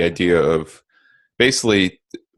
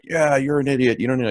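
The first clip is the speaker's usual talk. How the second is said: higher-pitched mocking comeback; mock reactive retort; 7 semitones higher than usual. Someone feeding the drama with a reply, mock reactive retort. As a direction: imitate a knee-jerk insult, pitched up. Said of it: "somebody's really reactionary and you're like".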